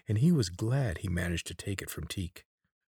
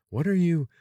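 put-on young Corvin's voice; 12 semitones higher, 8 dB louder than usual. A narrator's reading. Corvin interrupted.

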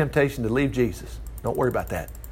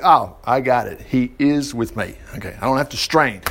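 relieved realization; 7 semitones lower, 3 dB quieter than usual. Pretend. Preacher voicing a reader who finally understands, low and calm. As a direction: let it dawn on you calmly. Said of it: "you'll be like".

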